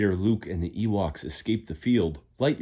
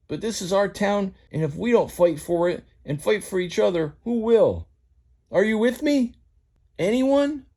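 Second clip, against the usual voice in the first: high, twangy tow-truck pleading with friends; rally the scared town, pleading higher and higher; rousing Mater speech; 9 semitones higher, 4 dB louder than usual. Acted. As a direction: Mater begging his friends to fight, voice climbing.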